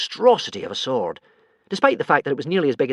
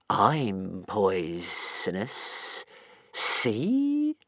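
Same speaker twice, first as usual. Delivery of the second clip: I'm poisonous drawled very slow and soft; slow creepy I'm poisonous; menacing creature; 3 semitones lower, 3 dB quieter, much slower than usual. Acted. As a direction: purr poisonous as a threat. Strangulator shows its tail.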